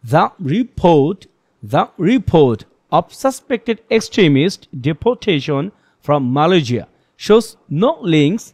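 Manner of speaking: very slow, a pause after every word; paused reading demo. Shown as a demonstration. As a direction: read word by word with measured gaps. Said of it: "report আর of এর মাঝখানে পজটা কিন্তু তার চেয়েও বড় হবে". (প্রতিটি শব্দের পর বিরতি দিয়ে ধীরে পড়া)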